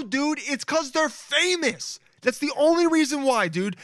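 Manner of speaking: worked-up, emphatic complaint; exasperated rant. Real, not acted. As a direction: raise the pitch way up and insist. Exasperated rant about fame.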